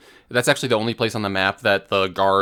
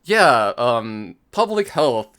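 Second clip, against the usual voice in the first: bright, louder fake enthusiasm; upbeat mock quote from a stranger; mock cheery reply; 3 semitones higher, 5 dB louder, much slower than usual. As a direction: play the chipper believer. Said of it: "They're either just like".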